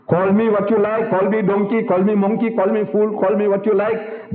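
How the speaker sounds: high, insistent run of repeated challenges; defiant challenge. Acted. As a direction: hold it up high, rattling off each insult evenly. Acting out defence of one's mother.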